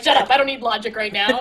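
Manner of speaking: rattled off fast and dismissive; hurried mock annoyance; fast brush-off. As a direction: snap it out quickly. Quoting a stubborn male lead.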